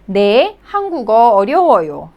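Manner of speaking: ordinary talk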